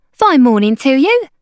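high, loud sing-out greeting; hawker woman's greeting. A narrator's reading. Hawker hailing strangers on the road, high loud greeting. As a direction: call it out like a market seller.

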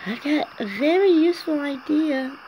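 character voice. Acted. Character voice, high.

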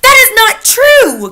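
very high, loud, slow shriek of protest; outraged cry. Acted. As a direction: shriek the denial. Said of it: "cried Mister Stringer".

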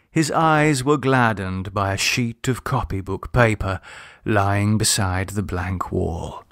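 ordinary delivery, nothing marked

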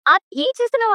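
sharp, high ఏం చేస్తున్నావు as she is grabbed; alarmed cry. Acted. Sister being pushed out by brother. (ఏం చేస్తున్నావు అని భయంతో ఎత్తు స్వరంలో అరవడం)

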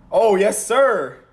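high, loud cheering yell; excited yell. Real, not acted. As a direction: whoop it out in triumph. Celebrating the pick.

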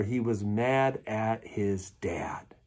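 slow stage whisper. Real, not acted. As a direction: lean in and whisper it. Confiding point to congregation.

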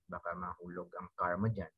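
ordinary talk

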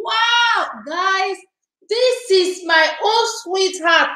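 high, thrilled announcement; high excited introduction. Real, not acted. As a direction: show her off with delight. Excited about friend arriving.

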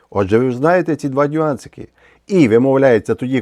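usual speaking voice

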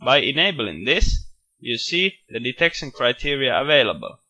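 usual speaking voice

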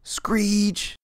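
high screeching bird cry; a piercing eagle call. Acted.

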